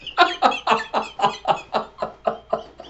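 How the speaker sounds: high, breath-heavy run of laughter; real giggling fit. Real, not acted. Amused by the characters' exchange, real long giggle. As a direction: crack up helplessly. Cracks up at the story.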